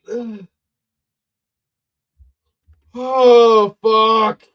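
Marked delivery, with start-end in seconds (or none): 2.9-4.4 loud, exasperated curse; frustrated outburst. Real, not acted. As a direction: blurt it out in frustration.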